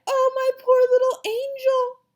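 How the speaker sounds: high, loud, anguished fussing; mother crying out. A narrator's reading. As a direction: wail over your poor child. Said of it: "my mother cried".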